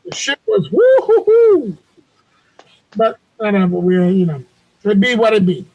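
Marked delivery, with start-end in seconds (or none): 0.7-1.8 high, drawn-out woo-hoo; swooping high whoop; delighted whoop. Real, not acted. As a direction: whoop in admiration, stretched out high. Raving about how good it was.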